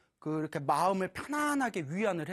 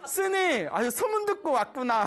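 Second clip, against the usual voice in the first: delighted greeting; 7 semitones higher, 4 dB louder than usual. Retold or imitated. Mother happily greets the monk.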